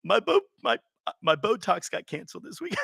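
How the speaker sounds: high, mock-tearful whine; crying supermodel. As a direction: play a weepy model over a trivial woe. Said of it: "if, like, a supermodel's crying".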